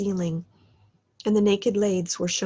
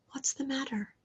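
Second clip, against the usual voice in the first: whispered, higher question; quiet question; 5 semitones higher, 8 dB quieter than usual. A narrator's reading. The spirit asks Scrooge gently, whispered question. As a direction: ask gently under the breath.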